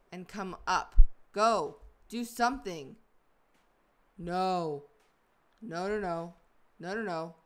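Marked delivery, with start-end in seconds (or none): 4.2-7.3 rising panic, repeated no no no; real frustrated panic; panicked no's. Real, not acted. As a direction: plead with the game, getting louder then fading. Stuck in a crevice, panicking.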